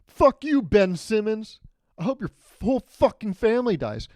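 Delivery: high-pitched mock fan abuse; hammed-up angry yell; yelling heckler. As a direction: heckle an athlete like an enraged fan. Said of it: "you literally yell at him".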